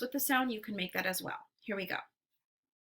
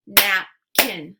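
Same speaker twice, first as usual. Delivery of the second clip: Napkin as loud "nap... kin"; split into syllables; 7 dB louder than usual. Shown as a demonstration. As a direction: punch out both halves of Napkin separately. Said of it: "give you two parts to a word".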